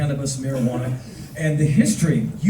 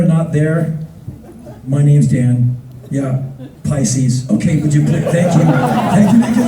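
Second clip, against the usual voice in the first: world-weary officer managing a crowd; bored cop; 5 dB louder than usual. Retold or imitated. Bored cop dealing with festival stoners, put-on official voice. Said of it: "more bored group of law officers".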